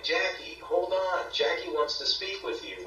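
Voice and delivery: spirit voices calling. Retold or imitated. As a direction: imitate voices summoning someone forward. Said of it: "like people bringing her forward".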